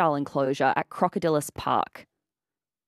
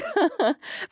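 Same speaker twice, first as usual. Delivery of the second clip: a high burst of real laughter; laughing; 13 semitones higher than usual. Real, not acted. Host laughing at her own crocodile tears story.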